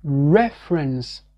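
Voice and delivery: REFERENCE with an English R, slowed right down. Shown as a demonstration. Teacher modelling reference for French learners, slow. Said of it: "c'est pas référence".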